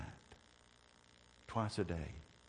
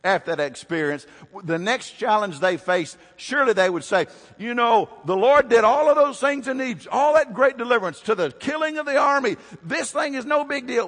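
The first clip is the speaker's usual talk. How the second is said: high, loud sermon delivery; preaching at full force; 13 dB louder than usual. Real, not acted. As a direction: belt it out to the congregation, pitch way up.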